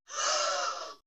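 loud, high crowd shriek on cue; the whole room screams on three; audience scream. Real, not acted. Speaker leads the audience's group scream, loud and high. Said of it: "give me a nice big scream".

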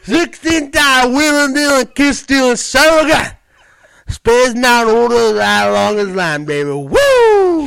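very loud, high, drawn-out wrestler promo; Ric Flair. Acted. Comic starting his example, full-blast wrestler impression. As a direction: holler it like a boasting wrestler, ending in a whoop. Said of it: "start off with Ric Flair".

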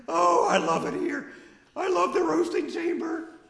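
put-on high, eager kid voice; squeaky young burger worker; high-voiced nervous teen. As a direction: chatter like a jittery fast food kid. Holds Billy Bopper voice.

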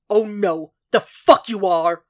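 slow, emphatic angry refusal; snarling. A narrator's reading. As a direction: snarl it, forbidding him outright. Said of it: "Jack snarled".

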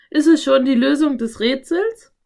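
excited question lifting at the end. A narrator's reading. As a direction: ask it bursting with anticipation. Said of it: "fragte er aufgeregt".

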